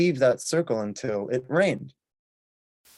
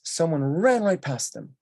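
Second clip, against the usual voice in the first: ordinary talk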